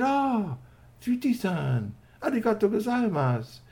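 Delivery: high-pitched little character voice; high, polite toy voice; grateful toy robot. Acted. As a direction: thank a friend in a small, high voice. Revived toy robot thanks his friend.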